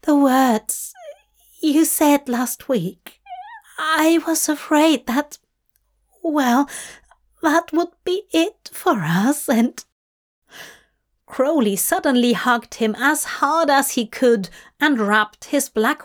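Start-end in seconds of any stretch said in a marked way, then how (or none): 0.0-9.8 higher, halting, upset voice; pouty, anxious explanation with pauses; pouting worry. Acted. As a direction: confess the fear haltingly, pitched up. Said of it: "Aziraphale pouted".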